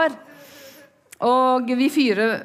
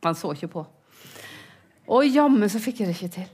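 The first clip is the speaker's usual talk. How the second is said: usual speaking voice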